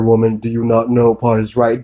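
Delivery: ordinary talk, nothing marked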